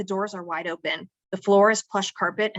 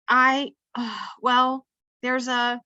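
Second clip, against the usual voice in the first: halting, higher, drawn-out stall; hesitant stammer; 5 semitones higher, slower than usual. A narrator's reading. Girl dodging her roommate's question, hesitant. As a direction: stall for words, losing your nerve. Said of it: "I totally chicken out".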